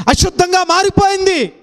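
high, loud climactic preaching; preaching at full force. Acted. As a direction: build the sermon to a ringing peak. (ఉద్రేకంతో బోధించే స్వరం)

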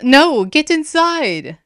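high, loud, drawn-out order; insistent shout. A narrator's reading. Aster overruling the boy's protest, insistent shout.

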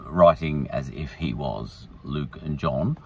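ordinary talk, nothing marked

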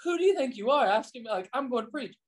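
high, quick, indignant mock huff; offended retort. Pastor retelling snapping back at Randall, high indignant huff. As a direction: play your younger, prideful self bristling. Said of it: "And I'm like".

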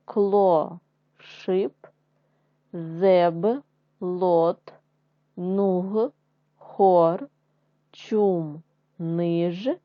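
slow, loud syllables one by one; syllable drill. Shown as a demonstration. Teacher drilling syllables for first graders, loud and slow. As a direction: read each syllable out firmly for first graders.

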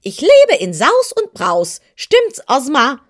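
high, loud, clear-cut hen voice; a proud hen crowing over her good life, pitched high; shrill hen voice. Acted. The hen boasts to Dorothy and Ozma, high and loud. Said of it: "mit schriller, deutlicher Stimme".

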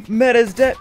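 loud, high alarm; alarmed shout. Real, not acted. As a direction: yell that a teammate fell. Teammate killed.

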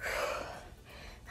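panting after a workout; breathless exclamation; out of breath. Real, not acted. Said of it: "I'm out of breath".

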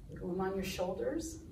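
soft, low, quick delivery; low and quiet. Shown as a demonstration. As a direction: drop the voice right down. Action song, contrasting body heights.

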